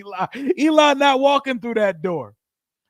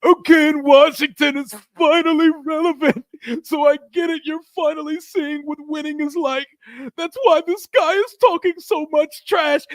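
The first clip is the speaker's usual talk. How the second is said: higher, louder mock-whiny rival fan; Giants guy's voice; 7 semitones higher, 5 dB louder than usual. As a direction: read it as a crying Giants fan. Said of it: "Let's read this in his voice".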